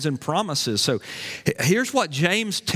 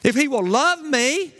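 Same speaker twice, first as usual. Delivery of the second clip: mock reluctant wife; 9 semitones higher than usual. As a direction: play the wife haggling over conditions. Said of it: "not like".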